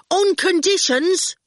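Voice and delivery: high, slow, emphatic shout. A narrator's reading. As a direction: call out the catch slowly. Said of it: "Ginger shouted".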